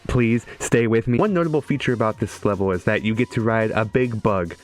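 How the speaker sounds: ordinary talk, nothing marked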